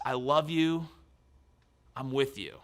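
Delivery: ordinary delivery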